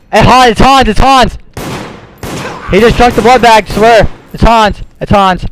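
high, loud, insistent accusation; urgent accusing shout. Real, not acted. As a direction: yell it so everyone believes you. Urgently accusing Hans to everyone.

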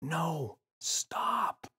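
near-silent whispered plea; mouthed silently. A narrator's reading. James mouthing to the gorilla, near-silent. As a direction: barely breathe the words. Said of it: "silently mouthed the words".

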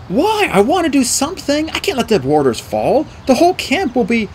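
high, pleading outburst; impassioned protest. A narrator's reading. Boy begs his mentor to act, impassioned protest. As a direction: protest urgently, pitched well up.

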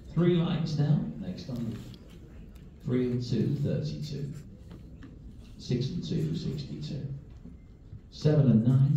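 steady sing-song number calling; bingo caller. Acted. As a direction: call each number out to the hall.

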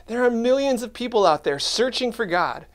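ordinary talk

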